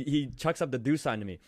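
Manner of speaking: normal talking voice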